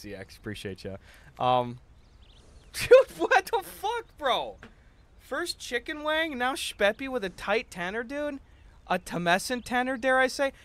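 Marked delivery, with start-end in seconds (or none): 2.3-10.5 high squeal of disbelief; incredulous laugh. Real, not acted. Streamer reacting to chat's silly names, squealing in disbelief. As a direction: shriek it in mock outrage, pitch way up.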